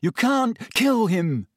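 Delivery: high, urgent breathless protest; breathless, alarmed objection. A narrator's reading. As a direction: plead slowly, as if short of breath. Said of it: "he said breathlessly".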